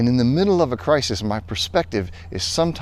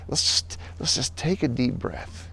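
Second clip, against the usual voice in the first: calming hush; 9 dB quieter than usual. Real, not acted. Calming the audience.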